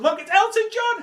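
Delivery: high-pitched starstruck squeal; excited showing off. Acted. Fan going live from the Rolls Royce, excited squeal. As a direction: burst upward with mock thrill.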